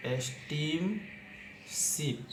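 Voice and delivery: एस्टीमशिप stretched very slowly while writing; एस्टीमशिप spoken very slowly as it is written; drawn-out word. Shown as a demonstration. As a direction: draw out एस्टीमशिप syllable by syllable. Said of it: "लिखेंगे".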